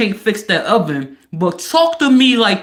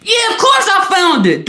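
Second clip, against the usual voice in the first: shouted high with a laugh; husband snapping at his wife; mock yell; 11 semitones higher, 5 dB louder than usual. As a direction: yell it back at her, laughing.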